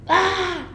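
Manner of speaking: high yelp into a breathy laugh; jump, then laugh it off; startled yelp and laugh. Real, not acted. Sudden scream then laugh.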